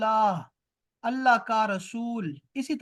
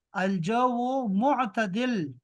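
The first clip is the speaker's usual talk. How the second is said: "الجو معتدل" very slow, syllables drawn out; slow model pronunciation; much slower than usual. Shown as a demonstration. Teacher giving students a weather answer, slowly and deliberately.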